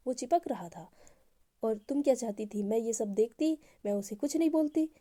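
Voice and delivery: loud, heated jealous outburst; angry. A narrator's reading. Eva angrily defends herself to Anu about the clingy guy, loudly. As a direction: fire back, raising the voice. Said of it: "थोड़ा सा गुस्से में".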